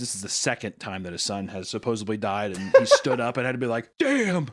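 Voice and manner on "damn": mock yell. Imitating father's yell for vaporized son.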